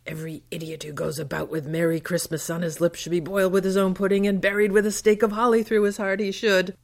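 high, fast and rising to a loud, spiteful rant; Scrooge. Retold or imitated. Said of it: "the misanthropy of Scrooge".

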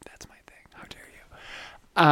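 retold indignant whisper; mock offended whisper. Podcaster retelling someone stealing her thing, mock offended whisper. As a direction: hiss it under the breath in mock offence. I was like.

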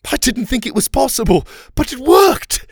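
high, loud jubilant character voice; overjoyed little shout, very high-pitched; cheering. A narrator's reading. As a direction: cheer excitedly, climbing to a squeal at the end. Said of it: "he cheered".

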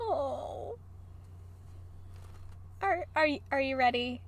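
soft quiet tease; hushed teasing. Real, not acted. Asking her scared cohost if she's ready, soft and hushed.

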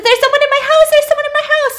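very high, loud acted panic; shrieky mock alarm, repeating in a rush of fear; panicked. Acting Claire on the phone about an intruder, panicked.

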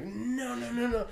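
high, rapid-fire refusals; acted panic. Man running out of the house, high panicky voice. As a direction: reel off high-pitched panicky denials.

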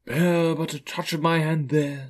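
solemn spirit's gentle bidding; reassuring ghost. Acted. Ghost reassures frightened Scrooge.

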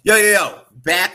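loud, high "Yo, yo, yo" greeting; hyped shout. Real, not acted. Host welcoming viewers back after the ad, shouting hyped. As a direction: hype the crowd back in after the break.

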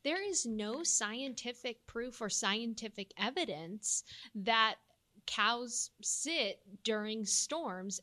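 ordinary delivery

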